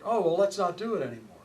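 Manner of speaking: normal talking voice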